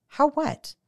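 high, loud snap; snapped. A narrator's reading. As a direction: bite the question back at them. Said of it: "I snapped".

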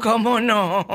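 teasing, laughing repeat; amused mocking echo. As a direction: echo the catchphrase through a laugh. Echoes the sidekick's catchphrase, amused.

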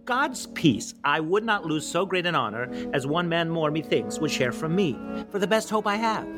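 stirring king's speech to his soldiers; amateur Shakespeare recital. Acted. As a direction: declaim it grandly, lifting key phrases high. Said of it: "presented to you by me in my".

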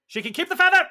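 high, loud snap of dismissal; indignant outburst. Acted. El Supremo scorning the feather gift, indignant and loud. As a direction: fling the refusal back at her.

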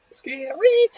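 very high bird shriek; blackbird screech. Pretend. Blackbird interrupting the story, screeched squawk.

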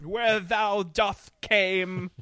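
slow, drawn-out Southern twang crossed with Cockney; mock Southern-Cockney accent. Mocking a film character's accent to a co-host, slow Southern-Cockney. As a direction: stretch it out in a bad Southern-Cockney mix. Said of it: "going in between. It sounds like a Southern twang".

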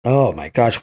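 ordinary talk, nothing marked

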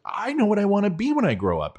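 put-on high, eager little girl calling out. Neighbour's little daughter calls to Mister Bauer, high childlike voice. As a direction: pitch way up like an excited kid.

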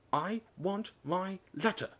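high, slow, word-by-word demand; shouted demand. A narrator's reading. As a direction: shout it one word at a time. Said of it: "he shouted".

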